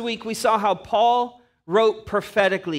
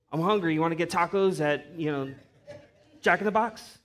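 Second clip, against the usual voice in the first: stoner; 3 semitones lower, 5 dB quieter, faster than usual. Retold or imitated. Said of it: "got high on some of that weed".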